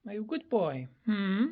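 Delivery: high, sing-song voice for the bird; cooing pet talk. Real, not acted. Owner coos at her bird, high and sing-song.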